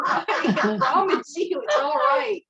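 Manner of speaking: high, loud reassurance through laughter; laughing reassurance. Retold or imitated. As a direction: retell the comforting words, cracking up. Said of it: "we're laughing at the same time".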